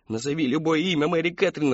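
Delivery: high, anguished begging; sobbing plea. A narrator's reading. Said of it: "рыдала мать".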